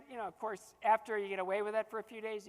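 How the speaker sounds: usual speaking voice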